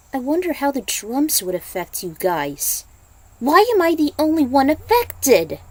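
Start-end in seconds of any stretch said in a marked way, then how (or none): 3.4-5.6 distressed. Acted. Distressed girl.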